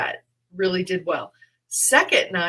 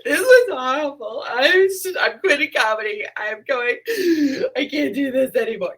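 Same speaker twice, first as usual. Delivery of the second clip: higher, louder, crying wail; mock despair; 7 semitones higher, 7 dB louder than usual. Comic phoning a friend after bombing, tearful wail. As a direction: wail it like it's the end of the world. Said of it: "And I'm like".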